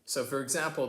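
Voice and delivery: ordinary delivery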